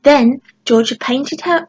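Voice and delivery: plain reading voice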